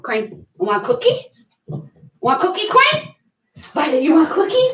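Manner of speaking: climbing high, coaxing; dog talk. Real, not acted. Owner offers her dog a cookie, high and coaxing. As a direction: coax the dog with a treat.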